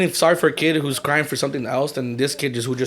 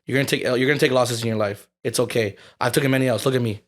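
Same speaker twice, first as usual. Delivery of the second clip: low, offhand reassurance; nonchalant; 4 semitones lower, 3 dB quieter than usual. Retold or imitated. Retelling a talk to a kid who lost, nonchalant. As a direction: play it down, like it's no big deal. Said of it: "like, nonchalant to one of these kids".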